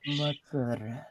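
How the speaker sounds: "Mozzarella" stretched out, soft; a slow, quiet "Mozzarella" on request. Shown as a demonstration. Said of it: "Say it again slower".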